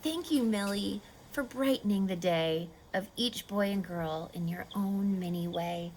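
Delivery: reading a picture book to little ones; storybook reading. A narrator's reading. Reader finishing a picture book for children, soft storybook voice.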